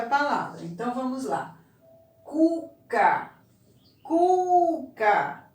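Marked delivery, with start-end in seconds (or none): 2.3-3.3 Cuca with a drawn-out, higher CU; stress on first syllable. Shown as a demonstration. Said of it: "Contorne a sílaba pronunciada com mais força". 4.0-5.4 Cuca with a long, high CU, falling on ca; stress on first syllable. Shown as a demonstration. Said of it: "Onde você demorou mais?".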